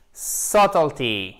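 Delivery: Subtlety said slowly, first syllable stressed, silent b; careful pronunciation model. Shown as a demonstration. Teacher showing viewers how the noun is pronounced, careful model. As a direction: draw out Subtlety as SUT-ul-tee, pitch falling. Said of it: "attention à la prononciation".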